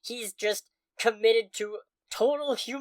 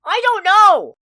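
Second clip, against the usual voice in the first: higher and much louder, flung out; exasperated outburst; 10 semitones higher, 10 dB louder than usual. Real, not acted. Host cuts off cohost's question, exasperated loud outburst. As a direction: throw up your hands and shout it.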